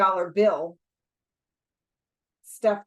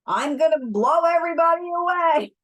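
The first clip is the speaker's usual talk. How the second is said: much higher, louder threatening yell; gunman's threat; 9 semitones higher, 6 dB louder than usual. Acted. Holdup man threatening the whole diner, yelled high and loud. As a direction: yell it like a man with nothing left.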